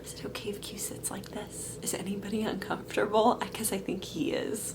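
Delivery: quick hushed whisper to the room; whispering aside. Real, not acted. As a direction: whisper it so the camera barely catches it. Aside to others about the dog.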